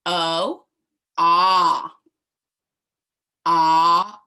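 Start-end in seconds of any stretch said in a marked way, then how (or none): 1.2-4.2 "ah" short and much louder, like an open cry; short o sound. Shown as a demonstration. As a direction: belt a loud open "ah" for O. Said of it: "Say it with me".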